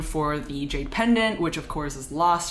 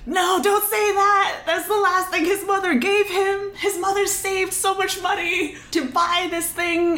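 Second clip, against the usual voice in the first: high, louder, panicky plea; frantic pleading; 13 semitones higher, 4 dB louder than usual. Retold or imitated. Hidden onlooker pleading in high panic. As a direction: beg them to stop, voice pitched way up. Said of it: "Shen Qingqiu in the back is like".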